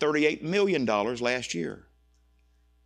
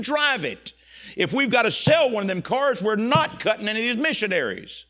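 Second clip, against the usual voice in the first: a louder, higher, insistent rebuke; stern scolding; 7 semitones higher, 7 dB louder than usual. Retold or imitated. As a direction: lay down the law to him. Coach scolding businessman on phone.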